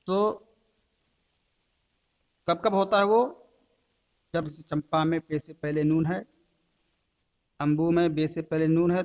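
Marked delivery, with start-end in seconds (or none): none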